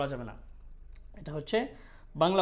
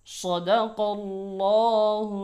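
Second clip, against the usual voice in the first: Quran recitation; 3 semitones higher than usual. Shown as a demonstration. Teacher reciting the verses for students. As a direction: chant the verses with long held notes. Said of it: "এই আয়াতটা আবার একবার বলছি".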